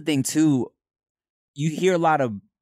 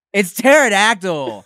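slow, loud, higher-pitched retort; loud insistence; 8 semitones higher, 7 dB louder, slower than usual. Retold or imitated. Insisting in a retold childhood argument.

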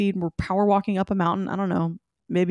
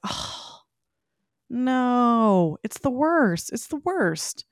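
mock dismay; 4 semitones higher, much slower than usual. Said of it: "I was like".

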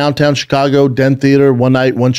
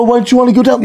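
mock victim; 10 semitones higher, faster than usual. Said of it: "I think the".

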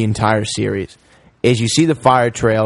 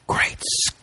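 soft breathless whisper; hushed imitation of Doc; whispered Great Scott; 8 dB quieter than usual. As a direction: whisper it like you're about to faint. Doc's famous line, whispered.

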